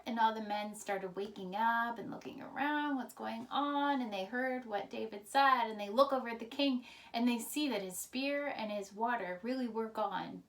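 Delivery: ordinary delivery, nothing marked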